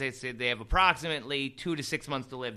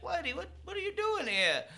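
confused high voice; puzzled, pitched way up; surprised. Retold or imitated. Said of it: "They're like".

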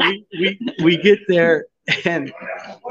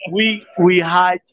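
ordinary talk, nothing marked

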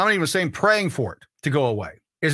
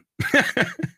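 high laugh; 10 semitones higher than usual. Real, not acted. Laughs at own impression.

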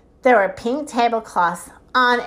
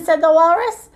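plain reading voice

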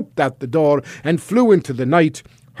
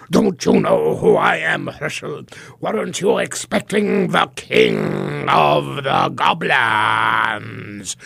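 goblin king; slower than usual. Acted. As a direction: taunt him slowly.

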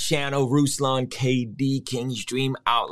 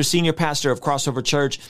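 ordinary talk, nothing marked

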